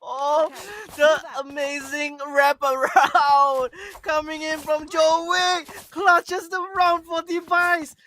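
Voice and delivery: high, slow, hyped recap; esports caster. Real, not acted. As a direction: savor the clutch play slowly.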